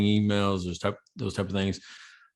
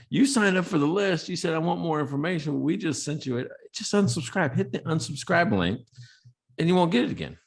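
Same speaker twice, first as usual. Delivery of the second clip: pitched up, incredulous explaining; exasperated reply; 8 semitones higher than usual. Retold or imitated. Host retelling his reply to the cussing subscriber, incredulous. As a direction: spell it out to him, half laughing in disbelief.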